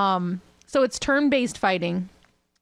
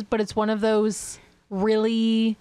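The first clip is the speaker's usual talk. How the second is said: ordinary talk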